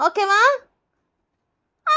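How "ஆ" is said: long, high yelp; child's pain scream. Acted. Pupil getting the injection, drawn-out scream. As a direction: scream like a jabbed kid. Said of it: "எதுக்கு இப்படி கத்துற?".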